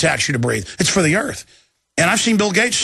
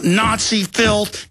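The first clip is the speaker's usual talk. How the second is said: normal talking voice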